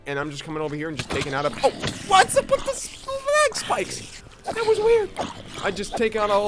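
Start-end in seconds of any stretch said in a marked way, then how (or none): 1.6-5.1 very high, louder surprised squeal; shocked squeak; startled squeak. Real, not acted. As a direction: jump at something strange. Sudden odd sight.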